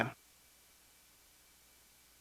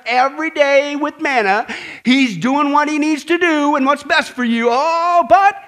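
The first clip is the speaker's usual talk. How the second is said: Moses answering back; 6 semitones higher, 4 dB louder than usual. Retold or imitated. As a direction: lay it out to the grumblers, pitched up. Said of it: "Moses and Aaron clued him in".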